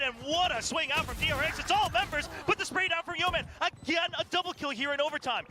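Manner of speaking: high, fast hype play-by-play; esports caster. Acted. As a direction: call every kill with rising energy. Play-by-play of overtime round.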